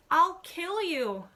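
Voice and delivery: slow, high, loud scream of rage; furious shriek. A narrator's reading. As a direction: shriek it at him in fury. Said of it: "Anne shrieks".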